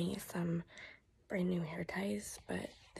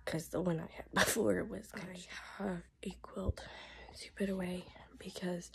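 hoarse whisper; barely any voice left; voice giving out. Real, not acted. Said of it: "I'm hoping that my voice will last".

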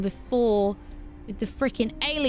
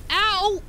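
pained yelp; 10 semitones higher, 4 dB louder than usual. Real, not acted. Burned by flower.

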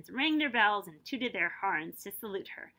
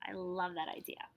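whisper; 4 semitones lower, 8 dB quieter than usual. Real, not acted. Aside while reading.

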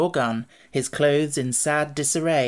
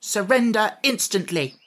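put-on a girl trying her best to sound terrible; small general barking a command; stern demand; 6 semitones higher than usual. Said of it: "frowning as terribly as her pretty face would allow".

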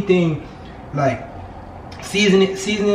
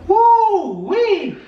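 high loud woo wee; excited whoop; 4 dB louder than usual. Real, not acted. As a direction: yelp from spicy heat. Reacting to chip heat.

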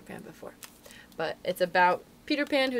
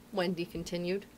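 plain reading voice

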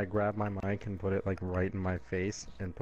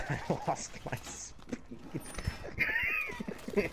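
laughing; slower than usual. Real, not acted. Laughing fit mid-game.

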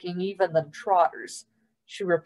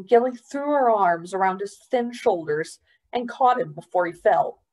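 plain reading voice

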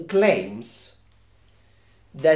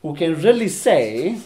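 normal talking voice

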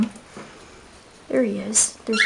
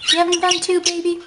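pet talk; 8 semitones higher, faster than usual. Real, not acted. Cooing to pet bird.

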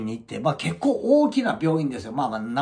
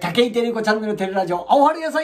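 bright, high announcer call of the show title; show opening call; 8 semitones higher, 5 dB louder than usual. Acted. Host opening his channel with a high announcer call.

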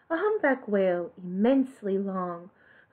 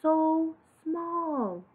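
high, soft, slow little snail voice; a tiny voice, awed and humbled; slow, wondering whisper of a small snail; 6 semitones higher, 4 dB quieter, slower than usual. Acted. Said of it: "she said to the whale".

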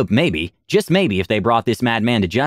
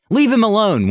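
high, raised crowd cry; mock shout; 10 semitones higher, 3 dB louder than usual. Refugee defending Vitor from the party, raised shout. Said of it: "one shouted".

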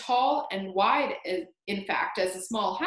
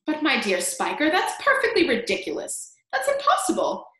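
loud, high-pitched disbelief; incredulous outburst; 6 semitones higher, 4 dB louder than usual. Acted. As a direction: protest in shrill astonishment. Aunts argue over growing peach.

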